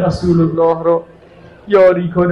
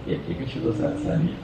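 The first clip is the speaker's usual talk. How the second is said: breathy whispered echo; 9 semitones higher, 11 dB quieter than usual. Acted. Repeated line, whispered echo.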